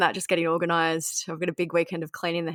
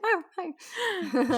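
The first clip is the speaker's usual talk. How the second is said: much higher, soft cooing hello; pet talk; 11 semitones higher, 4 dB quieter than usual. Real, not acted. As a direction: coo hi to the pet. Greeting the pet.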